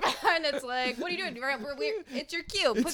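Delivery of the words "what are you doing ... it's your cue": higher, hurried backstage hiss; a lightly voiced panicking stage manager; frantic stage manager. Stage manager rushing Jason on, hurried high voice.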